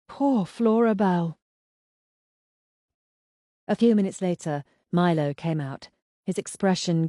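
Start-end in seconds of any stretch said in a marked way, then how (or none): 0.1-1.4 slow, stunned, a little higher on poor; dazed echo. A narrator's reading. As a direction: repeat it slowly, still in shock. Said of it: "repeated themselves over and over again".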